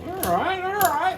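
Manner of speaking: high, rising all right twice; excited. Real, not acted. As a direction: perk up at the surprise. Reacting to a battle result.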